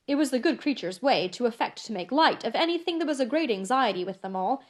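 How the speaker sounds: ordinary delivery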